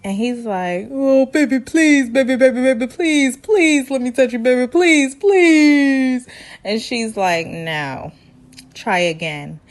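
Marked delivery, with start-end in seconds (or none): 0.9-6.3 high, whiny mock pleading; mock begging. Man begging his date to let him touch, mock desperate. 7.5-9.6 low, soft, unmoved no; mock curt refusal. Woman turning down her pleading date, mock unimpressed.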